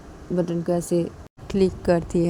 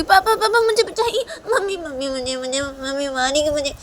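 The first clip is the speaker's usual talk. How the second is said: much higher pleading cries for help; mock panic; 9 semitones higher, 4 dB louder than usual. Daughter begs father to save her from mother, playful panic. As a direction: squeal for papa to save you.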